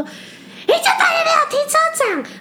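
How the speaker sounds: high, loud mock shout; angry yelling guest. Mother with a child yelling at hotel clerk, high loud mock shout. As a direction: shriek it like an outraged mother at the front desk. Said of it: "开始狂骂我".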